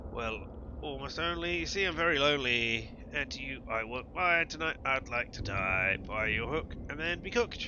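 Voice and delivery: a hint of a pleading hook creature, slow in places; lightly voiced lonely hook. Voices a fishing hook character.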